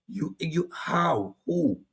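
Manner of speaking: baffled interviewer. Retold or imitated. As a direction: stumble as the lost interviewer.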